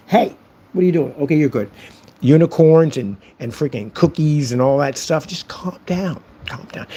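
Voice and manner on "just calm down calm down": soft, hushed fake soothing; mock calming. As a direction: hush them with pretend tenderness. Mock-calming the ranting trolls.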